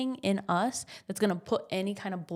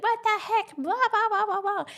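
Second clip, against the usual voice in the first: freak-out voice; 13 semitones higher than usual. Retold or imitated. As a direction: shriek it like you're losing it. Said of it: "have a freak out moment and be like".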